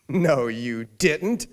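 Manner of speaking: slow, loud, laughing scoff; mocking disbelief. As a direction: drag it out, laughing at him. Stranger mocks his claim.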